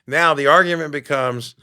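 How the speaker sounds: ordinary talk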